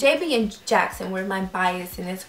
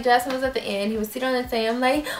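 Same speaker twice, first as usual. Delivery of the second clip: normal talking voice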